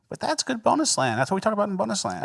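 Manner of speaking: high, quick quoted voice; mock protest. Host voicing an imagined clip, high mock protest. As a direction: pitch it up like someone objecting.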